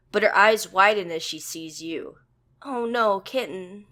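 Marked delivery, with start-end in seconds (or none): none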